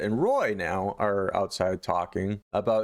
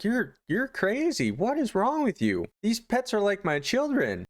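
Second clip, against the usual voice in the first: high, distraught wife voice; imitated horrified protest; terrified, mortified; 10 semitones higher than usual. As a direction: plead in mock horror. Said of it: "she's terrified".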